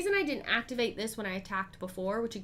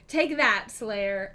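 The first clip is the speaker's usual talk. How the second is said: taunting cheer; 7 dB louder, much slower than usual. Real, not acted. Streamer taunting the killer Slayer after a good roll. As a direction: throw it at the villain like a win.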